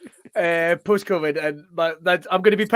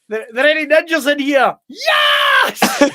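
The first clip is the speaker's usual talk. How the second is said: high mock ninja yell; hammed-up ninja shout; 7 semitones higher, 3 dB louder, slower than usual. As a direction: shout it like a cocky movie ninja. Acting out ninja movie.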